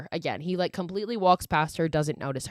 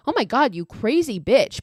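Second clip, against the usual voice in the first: higher, louder mock shock; retold alarmed outburst; 5 semitones higher, 6 dB louder than usual. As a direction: imitate a startled friend snapping out, half-playfully. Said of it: "calms down and she changes up".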